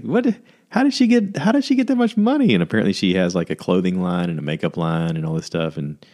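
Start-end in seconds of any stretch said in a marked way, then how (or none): 0.0-2.5 high, incredulous "how did she get that much money?"; mock astonished gossip; shocked disbelief. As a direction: squeal the question in disbelief. Retelling a surprised friend.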